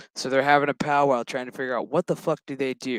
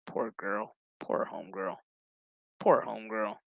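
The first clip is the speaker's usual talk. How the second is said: low, soft aside; muttered pity; 5 semitones lower, 7 dB quieter than usual. Real, not acted. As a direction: mutter it low, pityingly, under your breath. Muttered sympathy for character being recapped.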